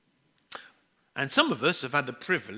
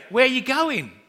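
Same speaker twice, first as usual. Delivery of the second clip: put-on higher, eager child's question; little boy, more natural; 9 semitones higher, 7 dB louder than usual. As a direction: ask it like a keen small son. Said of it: "so he would say".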